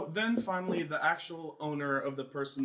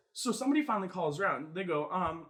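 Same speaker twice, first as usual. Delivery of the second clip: usual speaking voice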